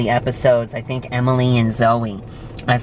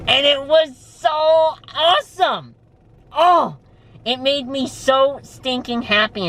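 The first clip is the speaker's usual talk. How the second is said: excited; 12 semitones higher than usual. Real, not acted. Delighted at getting a role.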